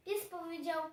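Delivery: usual speaking voice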